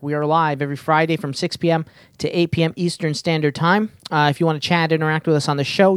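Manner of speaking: normal talking voice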